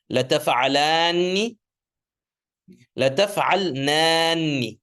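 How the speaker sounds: evenly chanted forms, each as its own phrase; say-after-me drill. Shown as a demonstration. Teacher leads class to say Arabic verb forms after him, drill recitation. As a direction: recite clearly for repetition, drawing out long vowels. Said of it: "say it after me".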